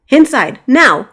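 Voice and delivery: loud urgent shout; shouted order. Acted. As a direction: yell it over the dragon. Knight orders princess inside.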